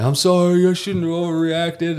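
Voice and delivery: imitating the contrite coworker; mock sheepish apology. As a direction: whine it like a dog with its tail down. Coworker comes back apologizing.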